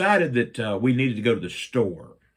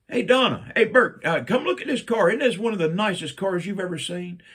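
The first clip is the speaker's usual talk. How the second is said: calling out; 8 semitones higher, 4 dB louder than usual. Retold or imitated. Mom calling relatives to car.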